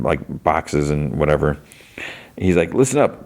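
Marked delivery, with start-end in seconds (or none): 2.7-3.2 higher-pitched rallying call; calling to attention. Retold or imitated. He addresses his pod crew, calling them to attention. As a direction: get the group's attention, pitched up.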